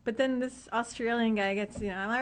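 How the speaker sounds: ordinary talk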